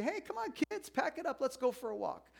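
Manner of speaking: high, bright mock call to the kids; hammed-up holiday dad rounding up the family; mock parent calling the kids out for a walk; cheery dad. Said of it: "You didn't go on vacation and say".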